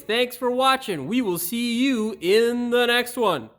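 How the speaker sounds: bright, loud sign-off like a YouTube host; hammed-up channel sign-off; upbeat outro read.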